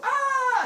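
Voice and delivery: very loud, high, drawn-out scream; full-out shriek of horror; villagers' scream. Retold or imitated. Villagers seeing inside the house, long high scream. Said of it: "villagers start screaming".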